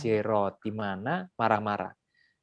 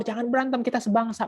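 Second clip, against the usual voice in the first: Moses rebuking; 11 semitones higher than usual. Acted. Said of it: "Pas Musa tegur".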